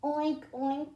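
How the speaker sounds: high, lightly voiced pig oink; pig grunting. A narrator's reading. Story reader voices the pig for children, grunting lightly. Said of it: "grunted the pig".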